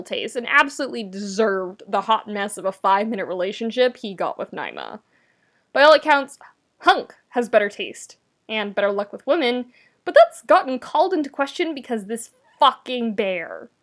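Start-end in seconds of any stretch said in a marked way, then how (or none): none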